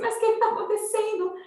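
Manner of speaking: high, urgent re-enacted question; retold alarm. Mother asks screaming son what is happening, high retold alarm.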